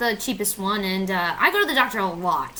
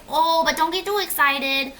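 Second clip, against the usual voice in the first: higher, cautioning agent voice; put-on agent voice; 6 semitones higher than usual. Insurance agent warning the customer, put-on voice. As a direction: play the agent dampening the good news. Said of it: "I don't know why I did that voice".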